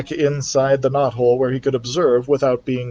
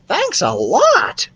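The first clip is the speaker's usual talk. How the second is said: higher voice, drawn out slowly; slow high mouse thanks; 12 semitones higher, much slower than usual. Acted. Ralph the mouse thanks Keith, slow and high. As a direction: stretch out a small thank you.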